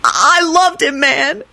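high lament ending in drawn-out howl; wail, then stretch the last words into a howl; wail to keening howl. A narrator's reading. Kenny mourns his muggle friend, keening. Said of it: "finished with a keening howl".

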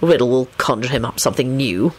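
low, grumpy mumble of complaint; grousing. A narrator's reading. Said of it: "Lupin groused".

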